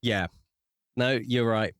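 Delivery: normal talking voice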